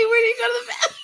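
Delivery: high, cracking with laughter; laughing hard. Real, not acted. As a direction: ask while cracking up.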